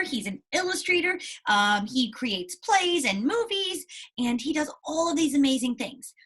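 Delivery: normal talking voice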